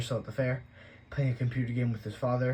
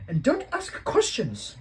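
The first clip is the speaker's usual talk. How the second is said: much higher, loud and slow, stern; Aunt Petunia scolding; 13 semitones higher, 8 dB louder, slower than usual. A narrator's reading. Aunt forbidding questions, slow, high and stern. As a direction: shut him down firmly. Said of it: "she said".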